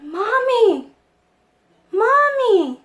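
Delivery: put-on high, loud child's cry for mother; excited calling out. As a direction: shout it out, thrilled, drawing out the word. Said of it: "I guess I was so excited".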